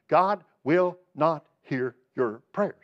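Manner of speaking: slowed, grave and deliberate; slow solemn warning. Real, not acted. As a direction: lay each word down slowly.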